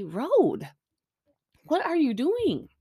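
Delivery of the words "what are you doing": high-pitched exasperated question; incredulous, higher-pitched telling-off. Real, not acted. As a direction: scold them in disbelief, pitch swooping up. Scolding the show over dusty road.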